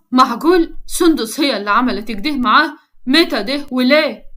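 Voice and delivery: ordinary delivery, nothing marked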